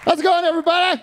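shouted stage greeting. Acted.

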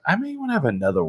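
high, fast giddy voice; mock future self. Teasing his pregnant friend in a giddy high voice. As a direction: squeal it like you've already forgotten the pain. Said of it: "you'll be like".

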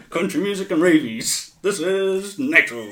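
loud, high mock TV hype; hyped announcer. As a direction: stretch it out like a big show slogan. Mocking the hyped commentary.

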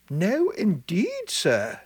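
high, slow gossip's voice; chattering old village woman. Acted. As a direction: voice an excitable old countrywoman.

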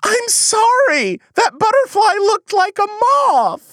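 a guilty suspect whining his excuse; high whiny apology. Acted. High whiny apology to the detective. Accused of killing butterfly.

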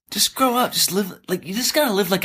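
high, sing-song preachy mock; mock a smug self-help guru. [name] mocks preachy tidying talk.

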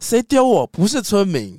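high, loud startled outcry; startled yell. Acted. San crying out at whoever throws stones, startled yell. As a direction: cry out at the attackers.

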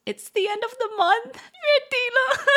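high, loud, whiny broke complaint; hammed-up month-end moan; mock lament. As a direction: whine that payday is far off.

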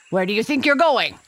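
high, loud and fast threat; lion yelling. A narrator's reading. Lion blocks the boy's way with a high, fast, loud threat. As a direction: snap it out loud and quick. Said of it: "the lion yelled".

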